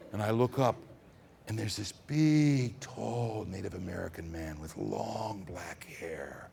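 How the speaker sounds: plain reading voice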